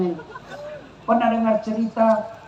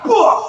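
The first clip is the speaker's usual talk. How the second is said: spitting out; 11 semitones higher, 5 dB louder than usual. Retold or imitated. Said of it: "langsung dimuntahkan".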